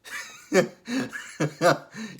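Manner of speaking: high giggling fit; real high-pitched laughing; amused laughter. Real, not acted. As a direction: crack up before finishing the story. Cracking up at the scene.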